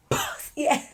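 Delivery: put-on high, spluttering drunk outburst; mock drunken yell. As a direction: spit it out like a yelling drunk, half laughing. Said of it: "like, yelling".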